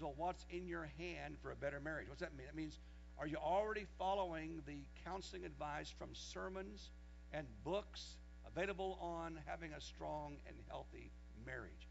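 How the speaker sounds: normal talking voice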